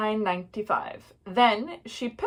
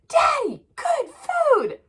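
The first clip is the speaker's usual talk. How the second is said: very high, loud squeal; squeaky kid enthusiasm; eager child voice; 5 dB louder, slower than usual. A narrator's reading. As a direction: sell it to Dad like an excited little kid. Child pleading in story.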